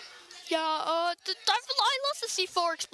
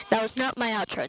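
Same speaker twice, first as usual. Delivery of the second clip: normal talking voice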